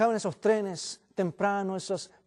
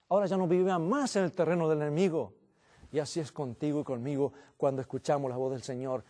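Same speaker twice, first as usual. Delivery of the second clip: usual speaking voice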